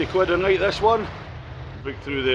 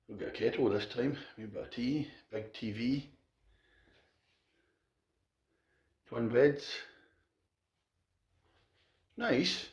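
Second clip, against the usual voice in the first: quiet; 3 semitones lower, 12 dB quieter than usual. Real, not acted. Hushed hotel room tour.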